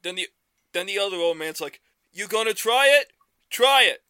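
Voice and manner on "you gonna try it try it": a hint of a cranky old man squawking, higher; mild mock challenge, pushed higher and louder. Old man dares the other to fight, mock squawk.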